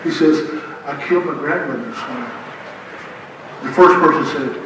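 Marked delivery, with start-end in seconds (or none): 0.9-2.3 soft, low, quick murmured aside; imitated whisper. President whispering to a guest in line, hushed and quick. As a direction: slip it under your breath like a secret. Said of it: "he whispers".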